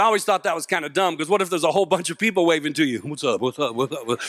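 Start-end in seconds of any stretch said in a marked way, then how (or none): 3.0-4.2 lower, softer laid-back "What's up?" again and again; repeated cool greeting. Retold or imitated. Speaker mocking cool drivers greeting a crowd, repeated low nods. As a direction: keep nodding it out low and cool.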